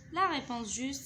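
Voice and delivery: ordinary talk, nothing marked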